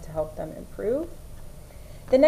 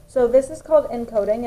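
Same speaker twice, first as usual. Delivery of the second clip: normal talking voice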